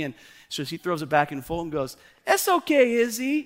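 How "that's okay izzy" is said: put-on small high-pitched kid voice, encouraging; high little-kid reassurance; little boy. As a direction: pipe up like a little boy cheering on a sibling. Dad voices four-year-old son.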